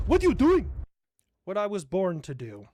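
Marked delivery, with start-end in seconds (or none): 0.1-0.7 high, loud alarmed question; alarmed cry. Pretend. A pilot yelling high at the bomber. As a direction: cry out in shock.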